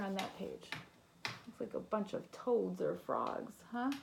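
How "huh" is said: usual speaking voice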